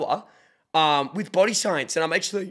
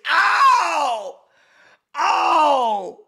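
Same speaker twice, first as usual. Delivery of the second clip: high, loud "ow" and "oh"; mock wounded howl; 11 semitones higher, 6 dB louder than usual. Streamer reacting to a roast, howling in mock pain. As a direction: cry out as if stung by a burn.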